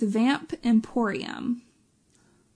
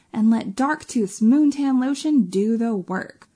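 ordinary talk

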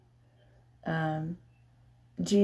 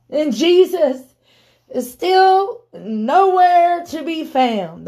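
impassioned preaching; 8 semitones higher, 4 dB louder than usual. Acted. Preacher telling church of Lazarus lying in the grave, impassioned. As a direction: preach it high with stretched phrases.

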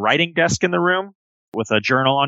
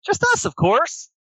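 high, sweet toy-animal voice; childlike plush friend; 12 semitones higher than usual. Acted. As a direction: speak like an eager stuffed elephant bear. GM voices Billy Bucks.

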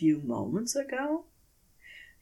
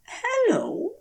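a much higher, rising hello, not quite frightened; uneasy high call into the empty woods; calling again, uneasy. A narrator's reading. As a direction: call out once more, a little unsure. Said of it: "he tries again".

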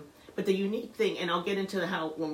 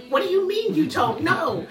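high, loud mock exasperation; incredulous mother; 12 semitones higher, 9 dB louder than usual. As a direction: raise your voice in disbelief, half laughing. Retold alarmed mother questioning daughter.

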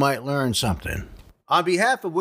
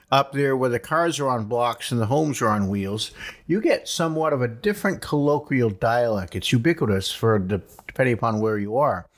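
ordinary delivery

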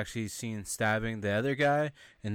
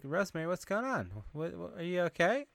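high-pitched imitation of a worried friend; mock concerned girl; 7 semitones higher, 4 dB quieter than usual. Girl checking on Rosemary in stall, mock concern. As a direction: ask in a put-on high voice.

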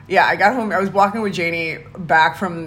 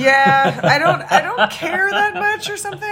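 high laughing reply; 12 semitones higher, 5 dB louder than usual. Real, not acted. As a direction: laugh through the words. Laughing at being teased.

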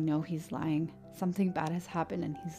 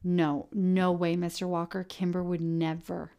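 protesting; 3 semitones higher, 6 dB louder, slower than usual. Acted. Kyle protests to the sheriff.

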